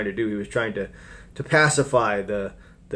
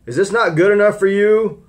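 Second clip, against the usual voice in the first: higher, louder exasperated Pilate; Pilate pleading; 9 semitones higher, 8 dB louder than usual. Retold or imitated. Preacher paraphrasing Pilate showing the beaten Jesus, raised voice. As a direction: push the crowd to be satisfied.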